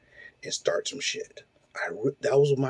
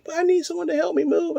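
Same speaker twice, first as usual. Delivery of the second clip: much higher mock plea; mimicking the ex. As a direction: squeak out her demand mockingly. Imitating ex's reply.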